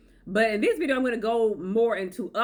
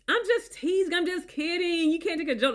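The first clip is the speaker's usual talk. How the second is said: much higher, breezy fake joking; mock teasing; 8 semitones higher than usual. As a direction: brush it off as a joke, high. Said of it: "disguising abuse as".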